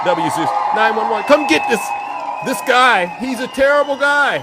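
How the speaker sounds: shouted high mock 911 call; mock panicked call. Host acting out women calling 911 on him, shouting. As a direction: yell it like a frantic caller to the police. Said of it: "the moment that man does something they don't like".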